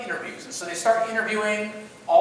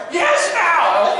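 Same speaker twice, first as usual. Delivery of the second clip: high, loud impatient order; imitated yelling; 10 semitones higher, 7 dB louder than usual. Drill instructor to hesitating cadet, exasperated yell. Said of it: "he starts yelling at me".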